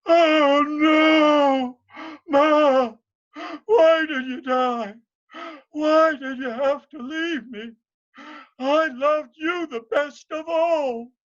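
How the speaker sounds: loud high wailing sob over his mother; wailing grief. Acted. Morgan wailing loudly at his dead mother. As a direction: cry out as if heartbroken.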